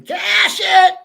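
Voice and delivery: high, loud drawn-out yell; screaming celebration. Retold or imitated. Co-host imitates the audio clip of them screaming, high shout. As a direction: belt it out like a screaming crowd. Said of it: "with them screaming".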